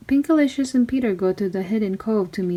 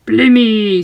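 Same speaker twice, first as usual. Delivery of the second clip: much louder exclamation; startled blimey; 8 dB louder than usual. Acted. Boy surprised the pirate is a friend, loud blurt. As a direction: blurt out in shock. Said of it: "said Peter".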